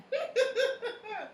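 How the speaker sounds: sudden high incredulous laugh; high laugh of disbelief at a late friend; high burst of laughter. Real, not acted. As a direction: crack up in disbelief.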